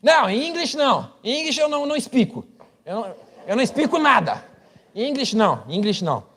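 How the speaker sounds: high pitched flustered refusal; flustered Brazilian. Retold or imitated. Pastor mocks a Brazilian refusing English, high and flustered. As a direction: squeak out a panicked no. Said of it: "Aí o cara".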